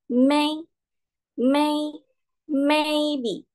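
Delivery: "may" high and held level; first-tone demo. Shown as a demonstration. Teacher reminding class of tone one, high level "may". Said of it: "Ingat, nada satu".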